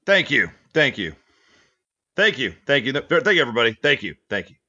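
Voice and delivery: smug thanks to an imaginary crowd; milk the applause that isn't there; mock applause thanks. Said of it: "I'm holding for the applause".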